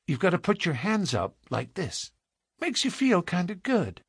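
put-on earnest small girl, gently bossy; instructing child. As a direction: show a grown-up how it's done. Child instructs man how to pray.

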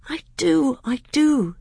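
put-on high, loud boyish eagerness; eager boy. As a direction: burst out in earnest agreement. Boy eagerly assures his tutor.

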